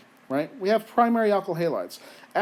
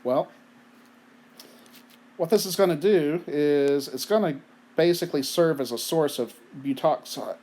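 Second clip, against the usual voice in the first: usual speaking voice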